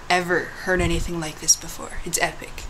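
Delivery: ordinary talk, nothing marked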